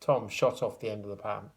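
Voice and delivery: ordinary delivery, nothing marked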